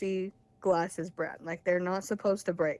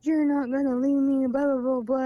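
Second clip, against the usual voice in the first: raised, louder imitation of his yelling; mock screaming; 6 semitones higher, 6 dB louder than usual. Imitating boyfriend who won't let her leave, mock screaming. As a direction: mimic an angry yell, high and pushed, short of screaming. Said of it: "he's, like, screaming at me".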